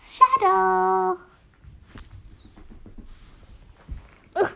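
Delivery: real pet-greeting voice; calling the cat. Real, not acted.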